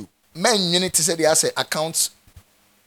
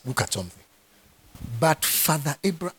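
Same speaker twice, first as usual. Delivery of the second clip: ordinary delivery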